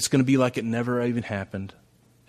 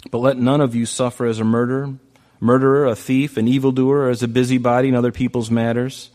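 scripture reading; 6 dB louder than usual. Acted.

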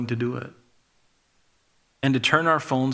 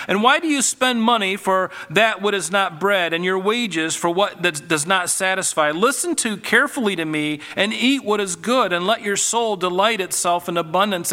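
higher, urgent pleading scripture read; God pleading; 4 semitones higher than usual. Acted. Preacher reads God calling people to come, pleading and raised. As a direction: plead with the listener through the verse. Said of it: "This is God pleading".

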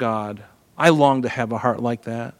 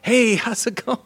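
high, giggling fake-cheerful greeting; laughing it off like nothing happened; nervous laugh; 9 semitones higher, 4 dB quieter than usual. Captive king greeting Samuel, nervous cheerful laugh. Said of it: "he's like".

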